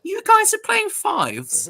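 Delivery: high, slow, incredulous posh English drawl; a posh English Londoner baffled by fives; posh English friend. Retold or imitated. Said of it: "and he went".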